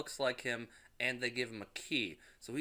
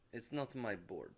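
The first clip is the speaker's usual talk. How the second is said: put-on Russian accent; 7 dB quieter than usual. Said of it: "And he's like".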